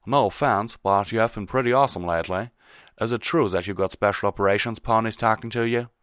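loud, quick country pony; folksy, fast questioning; prying Applejack. Acted. Applejack's character voice.

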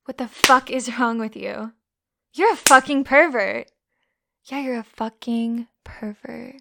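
loud, contemptuous shouting; angry yell. Acted. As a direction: bark the orders in disgust. Dom berates the listener.

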